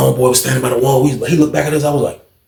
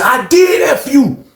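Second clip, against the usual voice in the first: high, slow shouted taunt; hammed-up repeat of an insult; acted yelled comeback; 11 semitones higher, slower than usual. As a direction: shout it stretched out for effect.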